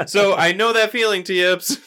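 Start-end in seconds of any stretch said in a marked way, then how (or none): none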